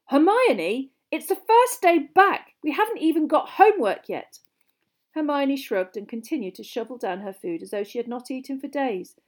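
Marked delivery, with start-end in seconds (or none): 0.1-4.4 disbelieving. A narrator's reading. Ron objecting to Hermione's library trip, incredulous. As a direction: protest as if it makes no sense. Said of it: "said Ron in disbelief".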